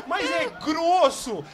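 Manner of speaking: slow words through laughter; laughing. Real, not acted. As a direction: laugh it out in disbelief. Laughing at the miss. (falando rindo)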